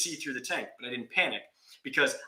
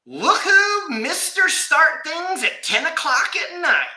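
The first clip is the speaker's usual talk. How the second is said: much higher, slow, drawn-out teasing; wife mocking her husband's late start, high and slow; 7 dB louder, slower than usual. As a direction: stretch out the wife's taunt. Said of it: "she's like".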